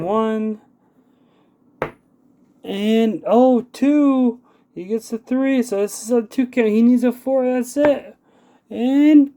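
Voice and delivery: high, loud, building pin-count call; excited. Acted. Climactic pin count in match.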